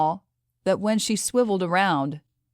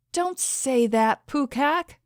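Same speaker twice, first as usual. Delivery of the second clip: higher, slow pleading cry; cried out; 8 semitones higher, slower than usual. A narrator's reading. Alina pleads with dying Pukak, crying out higher. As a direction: beg him not to say it. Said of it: "Alina cried out".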